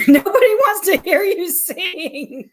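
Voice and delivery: laughing through the words. Real, not acted. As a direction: tease while giggling. Teasing partner while laughing.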